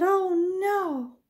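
put-on high, slow, breathy little girl; Goldilocks. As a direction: squeak it out like a small girl fussing over a bed. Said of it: "and said".

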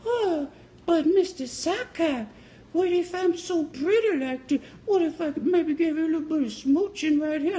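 high, slow falsetto flirt; pretty woman. Retold or imitated. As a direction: coax sweetly in a mock female voice. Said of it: "Even the pretty women's, they say".

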